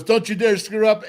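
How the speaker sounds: imitated coach laying down the law; coach's warning. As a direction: warn the rookie like a coach. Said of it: "Coach goes".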